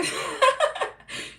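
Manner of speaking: loud, high-pitched burst of laughter; high breathless laughing fit; real laugh. Real, not acted. Finds the Jake comment funny.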